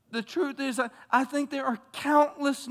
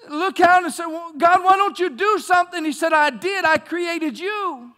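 loud preaching voice, building higher; full-force preaching; 7 semitones higher, 8 dB louder than usual. Acted. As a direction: preach it out big. Preaching climax, God's replies.

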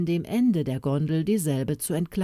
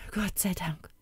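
soft murmur of relief, quieter; 6 dB quieter than usual. A narrator's reading. Father murmuring thanks over his rescued daughter. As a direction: breathe the thanks out softly. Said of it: "murmelten seine weißen Lippen".

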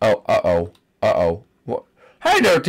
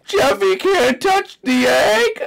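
high, slow whining cry; drawn-out childish cry; crying wail; 12 semitones higher, slower than usual. Acted. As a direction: wail it like a thwarted kid. Character frustrated, cries.

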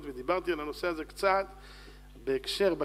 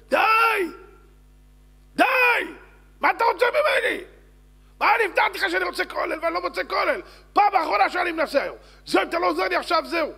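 high, loud, exasperated yelling at God; shouting; 13 semitones higher, 10 dB louder than usual. Acted. Man in the street protests to God, exasperated acted shouting. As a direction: yell an ultimatum at full force. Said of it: "הוא הולך ברחוב וצועק".

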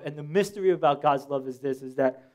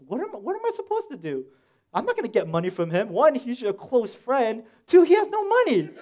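exasperated protest; 8 semitones higher than usual. Retold or imitated. Acts out protest to parents.

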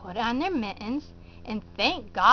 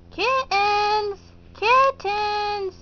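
calling the kittens; 7 semitones higher, much slower than usual. Pretend. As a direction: call them in for dinner. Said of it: "Help me call the kittens".

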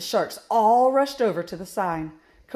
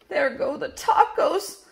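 high, put-on voice of disappointment; disappointed sigh; 7 semitones higher, 3 dB louder than usual. A narrator's reading. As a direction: lament a lost treat. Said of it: "sighed Flap".

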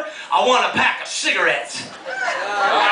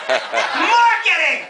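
mocking; 5 semitones higher, much slower than usual. Mocking repeat of job title.

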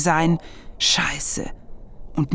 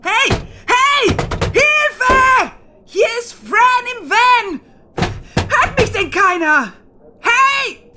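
loud, much higher desperate shouting; calling for help; 5 dB louder, much slower than usual. Acted. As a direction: yell to be heard from inside the van. Locked in van, calling out.

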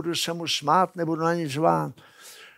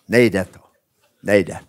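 ordinary delivery

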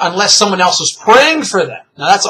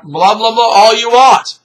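rising, louder sing-out of dismissal; mock-indulgent, stretched and raised; dismissive blah-blah; 6 semitones higher, 5 dB louder than usual. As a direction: wave it away, climbing higher and drawing it out. Dismisses hearing God without salvation.

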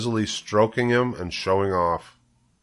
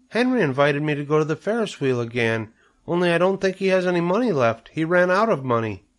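put-on high-pitched little girl explaining; child pleading; 8 semitones higher than usual. A narrator's reading. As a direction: make your case like an eager child. Child explains why she wants money.

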